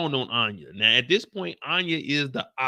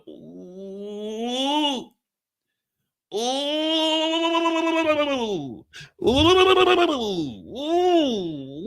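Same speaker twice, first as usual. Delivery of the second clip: taunting ooh; 12 semitones higher, much slower than usual. Retold or imitated. Kane menacing Anya.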